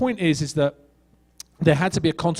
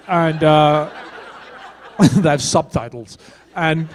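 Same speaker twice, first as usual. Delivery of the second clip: amused, laughing as he talks; 4 dB louder, slower than usual. Real, not acted. Actor telling the interviewer about his accent anecdote, laughing. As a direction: talk through a chuckle.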